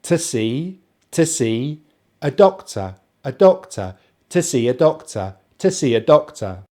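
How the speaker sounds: "to see a doctor" with weak to and a; joined-up drill. Shown as a demonstration. Teacher building the sentence, linked pronunciation drill. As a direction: link each phrase, stressing see and doctor. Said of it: "they're kind of joining together".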